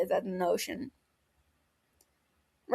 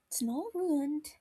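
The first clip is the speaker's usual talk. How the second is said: softer, slower reassurance; gently arguing; 7 semitones higher, 7 dB quieter, slower than usual. A narrator's reading. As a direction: gently push back, comforting him. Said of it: "Regulus argues gently".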